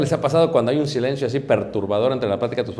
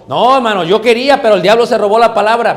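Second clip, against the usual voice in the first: higher, louder whiny excuse, mocking; 8 semitones higher, 10 dB louder than usual. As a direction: play the believer blaming the devil, loud and pleading.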